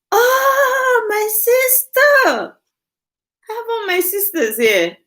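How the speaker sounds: overjoyed cry of recognition, stretched out; excited. Real, not acted. Host spotting her sister in the chat, excited squeal.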